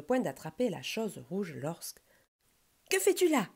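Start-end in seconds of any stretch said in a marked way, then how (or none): 2.9-3.5 high, loud startled challenge; indignant shout. A narrator's reading. Squirrel surprised by a climbing bear, indignant shout. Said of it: "cria l'écureuil".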